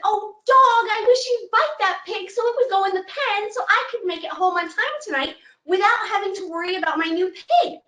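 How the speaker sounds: put-on high old woman voice asking a favor; old woman pleading. Old woman asks the dog to bite her pig.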